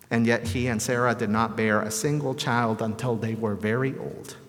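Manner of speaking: ordinary talk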